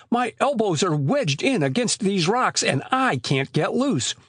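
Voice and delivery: exasperated protest. A narrator's reading. Trapped Joe protests he is wedged.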